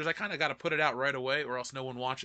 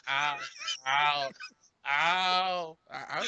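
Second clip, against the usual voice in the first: exaggerated accented vowel, getting louder; Annie Potts; 4 dB louder, much slower than usual. Host imagining the actress's voice from any woman, exaggerated impression. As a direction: push the mock vowel louder each time. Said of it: "Annie Potts' voice coming out of any woman".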